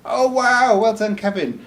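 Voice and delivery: high, loud "oh wow" of playful astonishment; mock amazement. Real, not acted. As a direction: act dazzled by the answer.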